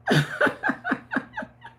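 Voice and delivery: squealing laugh, very high; amused high-pitched squeal. Real, not acted. Laughs at own joke.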